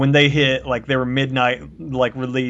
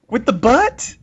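incredulous; 8 semitones higher, 5 dB louder, slower than usual. Real, not acted. Shocked at friend's ignorance.

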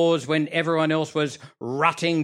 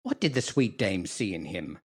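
usual speaking voice

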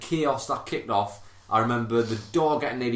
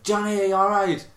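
high, hesitant call; sheepish; 7 semitones higher than usual. Retold or imitated. Bill calling to pinned Johnny, imitated sheepish call. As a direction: imitate a timid check-in, pitched up. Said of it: "sheepish as out".

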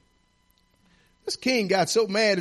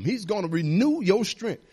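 ordinary talk

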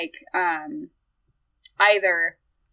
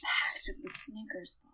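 breathy whispered aside; 13 dB quieter than usual. Pretend. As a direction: hush it like a secret pact. Whispered warlock pact joke.

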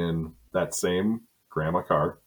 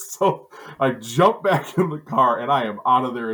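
words pushed out through laughter, a bit louder; laughing while talking; 5 semitones higher, 5 dB louder than usual. Real, not acted. Telling hosts how he fled, laughing through it. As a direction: keep laughing as you tell it.

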